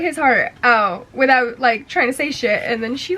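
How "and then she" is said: ordinary talk, nothing marked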